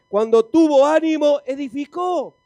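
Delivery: high, loud preacher's proclamation; rousing shout. Real, not acted. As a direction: drive the point home to the crowd. Rousing the congregation with the answer.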